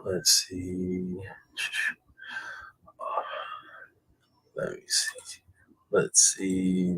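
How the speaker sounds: whispering while reading chat; whispered. Real, not acted. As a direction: whisper to yourself while scanning. Scanning chat under breath.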